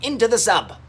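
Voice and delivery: high, loud order; urgent command. A narrator's reading. Cripes rescuing the friends, urgent loud call. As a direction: get them moving fast. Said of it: "cried Cripes".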